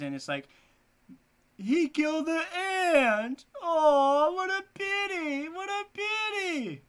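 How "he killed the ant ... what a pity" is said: high, loud, slow crying lament; video impression. Teacher imitates a video lament, high and wailing. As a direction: wail it like the silly video. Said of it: "do you remember that video?".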